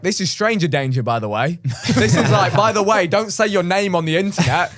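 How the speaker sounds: lightly voiced lecturing adult; a hint of a warning parent; mock safety warning. Adults warning kids about the internet, mild mock lecture. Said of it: "So it was like".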